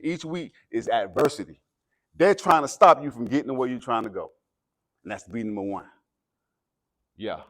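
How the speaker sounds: rising, loud motivational preaching; halftime pep speech. Acted. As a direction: fire up the team before the game. Escalating pep speech to team.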